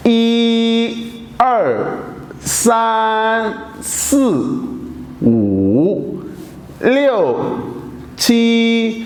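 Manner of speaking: 一 through 七 with deliberate Mandarin tones, 五 dipping far down; Chinese counting with tones. Shown as a demonstration. Teacher revising numbers for students, exaggerated Mandarin tones. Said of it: "तो once again".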